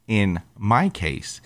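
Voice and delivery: ordinary talk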